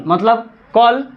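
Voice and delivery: normal talking voice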